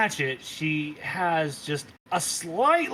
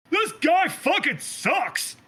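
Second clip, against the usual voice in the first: high, slow, yelled taunt; heckling yell; 13 semitones higher, 4 dB louder, slower than usual. Acted. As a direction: jeer at him from the crowd. Heckling Fae yells.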